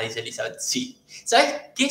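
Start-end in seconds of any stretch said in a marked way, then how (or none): 1.2-1.9 sharply higher ¿Sabes qué? to a viewer; pointed question. Real, not acted. Answering Elizabeth in chat, sharply higher. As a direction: jolt the viewer to attention.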